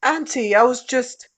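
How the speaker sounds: high, flustered stammer; caught out, accent dropped. Acted. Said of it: "he had dropped the accent".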